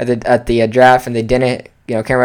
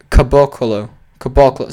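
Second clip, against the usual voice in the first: ordinary delivery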